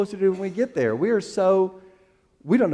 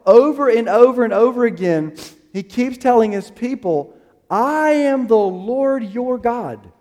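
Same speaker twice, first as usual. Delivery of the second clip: preaching; 6 semitones higher, 5 dB louder than usual. Acted. Preaching builds.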